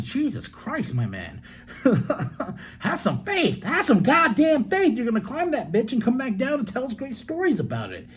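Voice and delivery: high, incredulous mock rant with laughs; exasperated outburst. Reacting to the promo, a high exasperated laughing rant. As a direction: urge him to have faith, half laughing. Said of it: "I'm like".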